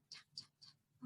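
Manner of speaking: soft unvoiced tapping sounds; tapping along. Shown as a demonstration. Librarian tapping along with the children, soft and unvoiced. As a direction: tap along softly like a leprechaun. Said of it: "Can you guys tap along like a leprechaun?".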